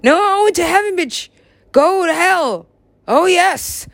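high, acted outburst; mock indignant retort. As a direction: snap back in a high mock protest. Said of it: "I was like".

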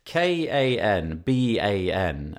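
K A N B A N, each letter slow and separate; spelling it out slowly. Shown as a demonstration.

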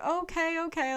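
high-pitched, startled little okay; taken aback. Retold or imitated. Confused dad answering his sobbing child, mildly taken aback. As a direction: imitate a confused parent trying to keep up. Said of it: "so taken back and so confused".

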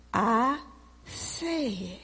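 very soft, spaced-out words; talking down. Retold or imitated. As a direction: spell it out quietly as if to someone slow. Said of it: "then I'll do it like this".

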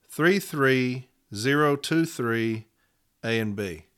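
slowly repeating the case number. Shown as a demonstration. Union rep repeating the case number slowly for members. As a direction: say it again slowly so they can write it down. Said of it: "and here's those numbers".

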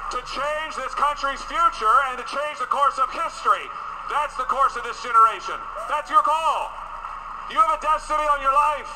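rising fervent preacher cry; preaching. Acted. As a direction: build it like a revival sermon. Preaches at full force.

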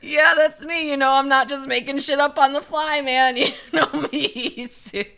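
high, loud, laughing mock boast; joking self-mockery. Teased host sending up own improvising, loud and laughing. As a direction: play it up big, pitched high, cracking up.

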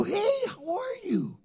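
high-pitched, drawn-out friendly hello; effusive greeting. Retold or imitated. Pastor greeting a man at the grocery store, effusive. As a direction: greet him like a long-lost pal, voice way up.

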